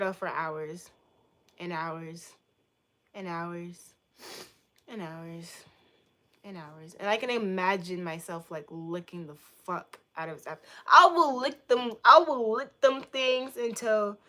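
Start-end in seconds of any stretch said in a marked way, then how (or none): 1.6-7.0 progressively softer and lower; fading repetition. Pretend. Fan dreaming of staring for hours, fading out. 10.9-14.2 much louder, higher declaration; emphatic fan outburst. Pretend. Fan overdoing devotion to an idol, loudly emphatic.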